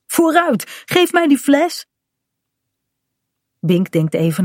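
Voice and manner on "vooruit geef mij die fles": put-on higher, insistent child's demand; eager demand. Kaat has caught Bink and wants the bottle, demanding. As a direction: push for it, pitched well up.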